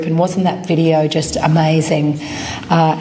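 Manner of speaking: ordinary talk, nothing marked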